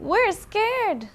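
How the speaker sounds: high, loud little fright; mock scared fox. Fox too frightened of the tall tree, high and loud. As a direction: squeak out the fear.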